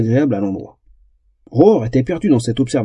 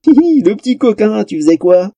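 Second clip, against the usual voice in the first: high, playful elf voice; teasing giggle; 7 semitones higher than usual. A narrator's reading. Elf teases the naked troll, giggling coyly. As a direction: tease with a naughty little titter.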